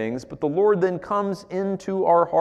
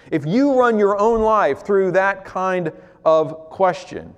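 ordinary talk

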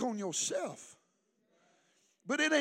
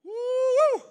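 high, drawn-out "woo"; rising church holler; excited whoop; 14 semitones higher, 7 dB louder than usual. Real, not acted. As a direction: let out a high preacher's whoop. Preacher's exclamation mid-sermon.

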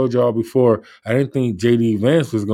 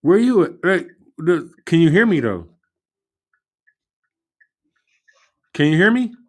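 raised, high voice over a bad connection; calling into bad line; 6 semitones higher than usual. Real, not acted. Bad video call connection.

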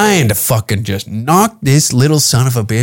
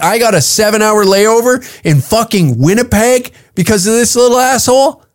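higher, complaining traveller stuck on a layover; mock fed-up passenger; 7 semitones higher than usual. Comic retelling a stranded passenger's rant, mock griping. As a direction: gripe like a stranded passenger, voice rising. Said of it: "and be like".

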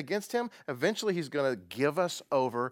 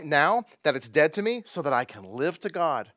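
ordinary talk

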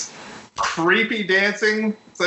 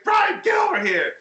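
high-pitched mock yell; imitating a summons; 14 semitones higher than usual. Friend mimics Ted calling Brian over, high mock shout. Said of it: "so he's like".

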